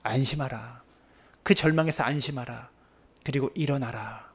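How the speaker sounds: soft, low comfort; tender. Acted. The Lord comforting the despairing, soft and low. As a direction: speak peace quietly. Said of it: "주님이 말씀하십니다".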